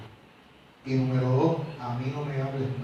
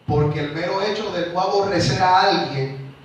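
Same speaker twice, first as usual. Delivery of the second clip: loud, higher, driving sermon voice; full-force preaching; 4 semitones higher, 7 dB louder than usual. Acted. Preacher driving home the law of Christ to his church, full force. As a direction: hammer the point home to the congregation.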